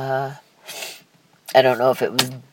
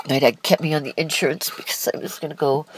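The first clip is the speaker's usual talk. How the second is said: usual speaking voice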